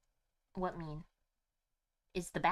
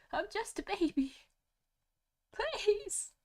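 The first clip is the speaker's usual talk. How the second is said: high, hammed-up begging; mock whiny pleading; 10 semitones higher, slower than usual. Streamer begging a crashing stream, mock-pitiful pleading. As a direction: beg higher and higher, playing the helpless baby.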